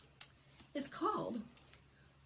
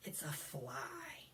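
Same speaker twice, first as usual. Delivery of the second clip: sighed reveal; 3 semitones lower, 10 dB quieter, much slower than usual. Acted. Sighing reveal.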